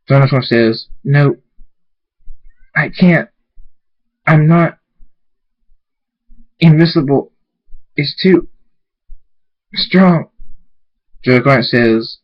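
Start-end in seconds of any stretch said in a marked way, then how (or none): 1.0-10.3 higher, halting struggle to speak; strained despair. A narrator's reading. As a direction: force it out with long pauses. Overpowered character struggles to answer.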